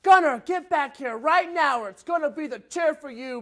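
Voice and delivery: high, loud yell; screaming at son. Retold or imitated. Father yelling at son heading to the river. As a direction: bellow a warning to a runaway kid. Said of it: "and I screamed".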